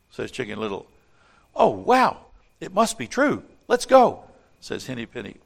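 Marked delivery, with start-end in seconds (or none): 1.6-4.2 excited hen. Acted. Henny Penny gets swept up.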